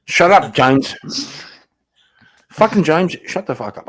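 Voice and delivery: loud, high annoyed yell. Real, not acted. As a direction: snap loudly at a friend. Yelling at friend James.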